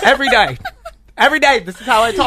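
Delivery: playful fake British accent. Shown as a demonstration. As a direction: insist in a hammy British voice. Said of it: "I talk like this every day".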